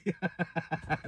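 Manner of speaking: high, real laughter; helpless laugh. Real, not acted. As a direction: crack up at your own joke. Cracks up after own imitation.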